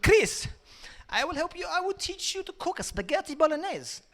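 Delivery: high-pitched mock Italian accent; mock Italian nan. Nan offering to teach Chris cooking, high mock Italian. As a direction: sing it out in a fake Italian accent. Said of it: "She talks like that 'cause she's fucking mental".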